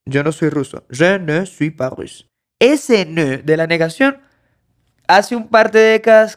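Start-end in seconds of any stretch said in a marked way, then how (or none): 0.9-2.3 "Je ne suis pas russe" slowed, "ne" drawn out; deliberate teacher's "Je ne suis pas russe"; slow careful French. Shown as a demonstration. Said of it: "el ne de la negación".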